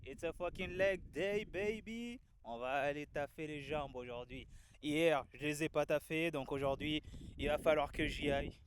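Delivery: loud and high over the wind; shouting over wind. Real, not acted. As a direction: project over gusting wind. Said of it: "vous m'entendez avec le vent". (crier par-dessus le vent)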